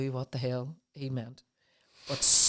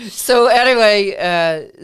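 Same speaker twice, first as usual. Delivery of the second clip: laughing; 5 semitones higher, 15 dB louder, slower than usual. Real, not acted. Recovering from laughter.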